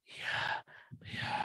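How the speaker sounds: barely audible whispered assent; mock whispering. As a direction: imitate a falsely gentle whisperer. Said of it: "Kalau ngomong bisik-bisik". (menirukan orang bicara bisik-bisik)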